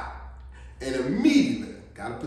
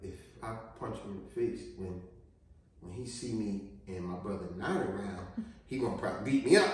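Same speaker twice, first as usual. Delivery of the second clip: much softer, lower, fast worried thought; inner thought; 9 semitones lower, 10 dB quieter, faster than usual. Retold or imitated. Nervous kid thinking to himself, soft and low. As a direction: run through it quietly in your head. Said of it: "in my mind".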